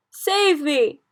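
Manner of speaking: high, loud plea for rescue; panicked giant's cry; terrified scream. A narrator's reading. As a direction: scream for help in a nightmare. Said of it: "screamed the Flesh Lump Eater".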